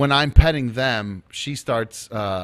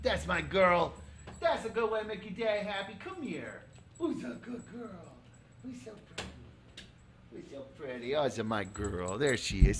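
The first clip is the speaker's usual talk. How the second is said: squeaky praising a dog; 8 semitones higher, 12 dB quieter than usual. Real, not acted. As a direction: coo praise at the puppy.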